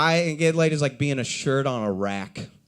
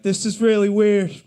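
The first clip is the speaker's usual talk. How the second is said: awkward loud blurt; 8 semitones higher, 7 dB louder than usual. Retold or imitated. Walking out, sweating.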